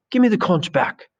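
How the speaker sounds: very high, urgent protest; indignant demand. Acted. Boy losing the conch in a scuffle, indignant high demand. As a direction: demand it back mid-tussle, pitch soaring.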